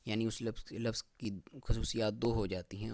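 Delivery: ordinary delivery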